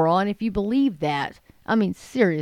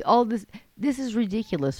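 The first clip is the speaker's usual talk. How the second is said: ordinary talk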